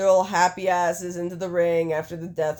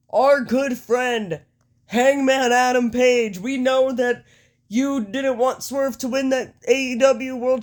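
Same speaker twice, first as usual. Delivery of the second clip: higher, measured wrestling promo with pauses between phrases; Nick Jackson; 5 semitones higher, 3 dB louder than usual. Acted. Wrestler explains himself to the crowd, mock-grand Nick Jackson impersonation. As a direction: orate to the arena in a raised, deliberate voice. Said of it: "Nick says".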